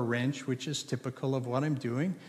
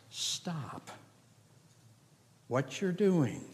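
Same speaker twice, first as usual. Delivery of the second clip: very soft, drawn-out stop; a pastor hushing his dear friends to stop; hushed appeal; 5 dB quieter, much slower than usual. Real, not acted. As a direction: drop to a hush to make them stop.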